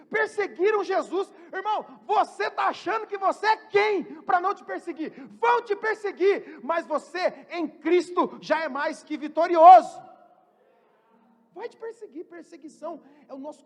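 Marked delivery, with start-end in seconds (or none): 0.1-10.0 loud, fired-up preaching; preaching shout. Real, not acted. Preacher rousing the church, loud and fervent. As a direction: build the crowd to a roar.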